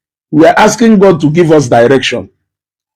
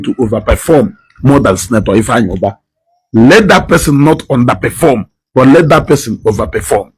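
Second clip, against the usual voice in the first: a low, steady prayer for the people; earnest prayer; 4 semitones lower than usual. Acted. Broadcaster praying for Anambra South, low earnest prayer.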